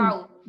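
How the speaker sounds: वाव said like the English "wow", loud and rounded; a clear, loud वाव like an amazed "wow"; Arabic waw. Shown as a demonstration. Teacher shows students वाव, said like English wow. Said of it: "यही sound आपको हर्फ वाव में अदा करना है".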